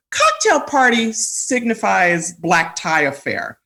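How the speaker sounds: slow, drawn-out grand definition; emphatic pronouncement. Real, not acted. Playfully grand definition of cocktail party.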